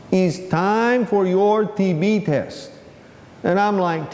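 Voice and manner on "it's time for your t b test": slowly drawn-out retold line; slow repeat. Assistant repeats himself to the puzzled inmate, slowly. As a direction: stretch each word out for the slow listener.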